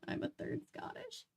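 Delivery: soft unvoiced aside; whispered aside. Real, not acted. Reader confiding her heritage to listeners in a whisper. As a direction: whisper it as a secret.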